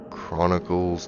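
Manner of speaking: ordinary talk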